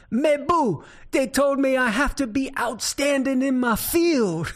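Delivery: put-on high Cajun accent, calling out; Thibodeau. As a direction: holler the punchline in a Cajun accent. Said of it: "And Thibodeau says".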